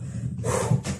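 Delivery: soft breathy sigh of relief; quiet exhale after running home; relieved sigh. Acted. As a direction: let the breath out, finally safe. Relief after bolting door.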